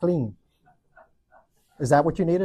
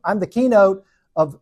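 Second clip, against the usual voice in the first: ordinary talk, nothing marked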